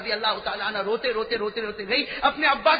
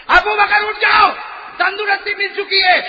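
booming call; 6 semitones higher, 8 dB louder than usual. Acted. Divine call repeated louder.

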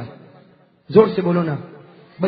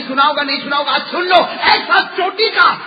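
loud, higher preaching at full force; fiery preaching; 6 semitones higher, 7 dB louder than usual. Acted. Preacher firing up the gathering about the saints, full-force preaching.

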